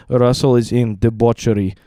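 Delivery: imitated Russian dad, solemn word by word; Russian accent. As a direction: put on a Russian father's accent. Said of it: "in his Russian accent".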